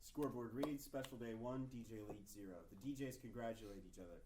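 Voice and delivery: usual speaking voice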